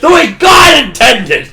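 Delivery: loud, slow, laughing proclamation; howling the phrase through real laughter; laughing shout. Real, not acted. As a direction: crack up and bellow it in mock reverence. Laughing cheer at the story.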